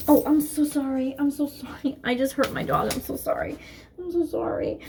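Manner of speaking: tender apology to a hurt dog; sorry to the dog. Real, not acted. Apologizing to the dog she bumped, soft and tender.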